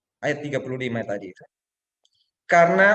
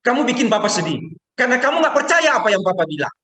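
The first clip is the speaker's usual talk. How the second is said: higher, louder scolding father; annoyed father; 9 semitones higher, 5 dB louder than usual. Retold or imitated. Father scolding his son for not trusting him, exasperated. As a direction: scold the child with hurt frustration. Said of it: "Saya bilang gini".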